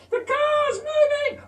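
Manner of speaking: put-on very high little-boy yell; toddler shout. Toddler shouting at his dad, very high. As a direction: squeal it in panic.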